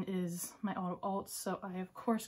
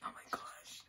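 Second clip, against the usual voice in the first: hushed, voiceless amazement; awed whisper; 9 dB quieter, slower than usual. Real, not acted. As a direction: whisper it as if overwhelmed. Overwhelmed by another photo.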